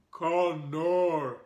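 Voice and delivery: a hint of a slow caveman; lightly voiced, lumbering caveman. Caveman greeting the boy, lightly voiced. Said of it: "he said, and smiled".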